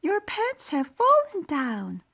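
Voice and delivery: high, loud shocked shriek; screaming. A narrator's reading. Ladies see the mouse's pants drop, shrieking. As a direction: shriek it in horror. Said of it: "But the ladies screamed".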